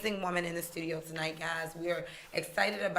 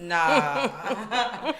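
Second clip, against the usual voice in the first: laughing; 4 dB louder than usual. Real, not acted. Laughing at meme.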